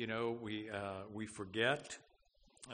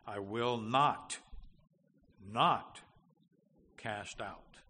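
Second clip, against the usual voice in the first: NOT said twice, louder, with a long pause between; emphatic repeated NOT; 6 dB louder, slower than usual. Shown as a demonstration. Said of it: "it's said for emphasis".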